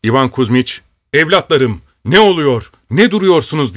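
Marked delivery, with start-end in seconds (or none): 1.1-3.8 higher, louder angry cry; yelling. A narrator's reading. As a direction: shout at the frozen soldiers. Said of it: "diye haykırdı".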